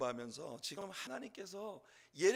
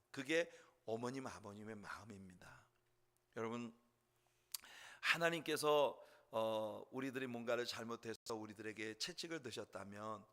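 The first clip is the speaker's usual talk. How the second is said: ordinary talk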